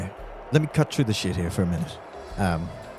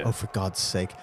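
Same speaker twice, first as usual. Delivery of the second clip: ordinary talk, nothing marked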